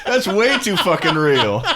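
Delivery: words squeezed out through high, loud laughter; barely getting it out while cracking up, slow; laughing hard. Real, not acted. Cohost losing it over the soup joke, laughing hard.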